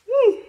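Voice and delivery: joyful high "woo"; high whoop. Real, not acted. Preacher telling of a childless couple, high whoop. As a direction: break off and whoop.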